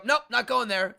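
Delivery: cabbie's refusal. Retold or imitated. Cabbie refusing the fare, acted out.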